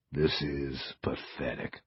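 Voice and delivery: low, slow and disgusted; disgusted mutter. Acted. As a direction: mutter it with contempt. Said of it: "Andre finally said".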